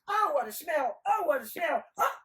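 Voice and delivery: high, blaring cry of disgust; hammed-up loud revulsion; loud exclaiming. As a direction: call it out high and heedless. Nasrudin exclaims it among the ladies.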